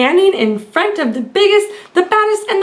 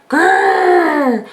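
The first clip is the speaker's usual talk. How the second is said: hammed-up storybook growl; bear growl. A narrator's reading. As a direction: growl out from behind the bush. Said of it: "the bear jumped up".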